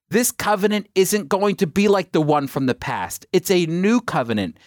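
ordinary talk, nothing marked

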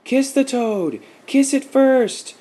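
put-on very high, loud kids' yelling; children crying out. A narrator's reading. Children in the pool urge Nikki on, crying out. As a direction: yell it shrilly like egging-on children, stretching the end. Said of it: "cried out the children".

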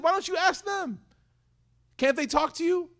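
very high, far-off mocking voice; mocking taunt. Ibrahim taunting the idolaters to ask their idols, high and mocking. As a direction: taunt them in a high put-on voice.

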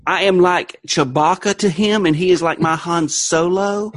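put-on Southern accent; Southern character voice. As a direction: play it as a drawling Southern character. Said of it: "I love that voice".

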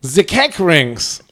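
slow, heavy German accent on "The cake rings". Shown as a demonstration. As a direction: say "zee kek rings" in a thick German accent. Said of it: "pronounce it in the German accent".

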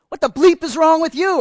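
loud, high Jersey yell; yelling back, Jersey style. Retold or imitated. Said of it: "I yelled right back, getting all Jersey on him".

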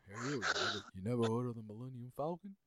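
mumbled, quiet Stallone voice; Stallone impression.

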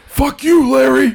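strained, high-pitched outburst; Marty Funkhauser. Acted. Cursing Larry in a strained, high impression. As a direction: strain the voice right up, cursing someone out. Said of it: "Marty Funkhauser".

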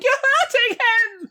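put-on very high child's wail; protesting little girl. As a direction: cry out in a tiny girl's voice. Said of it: "this little girl's hitting Murren ... be like".

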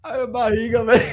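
breathless laughter, belly aching; laughing. Real, not acted. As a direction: gasp it out through laughter. Laughing till belly hurts.

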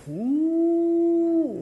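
long, high held "mm"; disapproving hum. Retold or imitated. Husband showing how his wife takes jokes, high hum. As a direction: mimic a displeased hum, drawn out high. Mimics wife's reaction to jokes.

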